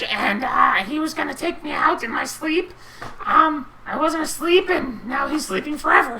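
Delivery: high, loud, swaggering goblin-lord voice; Little G. Acted. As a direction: boast like a brutal new boss. GM holds Little G's voice.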